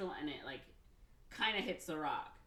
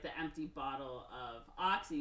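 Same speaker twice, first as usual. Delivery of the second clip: ordinary talk, nothing marked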